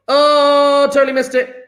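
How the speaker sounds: high, loud sudden realisation; surprised. Real, not acted. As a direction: catch your own slip out loud. Teacher notices his mistake.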